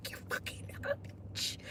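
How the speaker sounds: hissed, soft and voiceless; whispered insult. Retold or imitated. A Karen hissing an insult at the man she is filming, whispered. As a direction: spit the insult under the breath.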